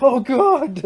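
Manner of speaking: high, loud cry of dread through laughter; hammed-up fearful wail; laughing mock terror. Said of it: "he's like".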